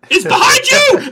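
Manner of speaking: loud high pantomime shout; panto crowd callback. Pretend. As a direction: yell it like a panto audience.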